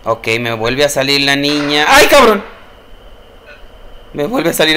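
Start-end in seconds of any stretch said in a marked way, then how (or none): 1.9-2.4 high loud yelp of fright; shocked shout; startled cry. Real, not acted. Girl reappears, scare.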